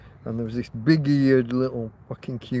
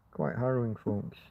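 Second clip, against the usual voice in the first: dropped to a soft murmur; quiet; 3 semitones lower, 9 dB quieter than usual. Real, not acted. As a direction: let it fade away quietly. Repeats phrase much softer.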